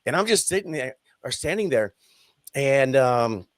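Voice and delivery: ordinary delivery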